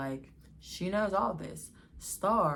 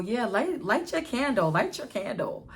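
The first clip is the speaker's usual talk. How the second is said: higher, louder sly mom voice; lightly voiced mother playing along; knowing mom; 7 semitones higher, 3 dB louder than usual. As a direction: tease them, she knows.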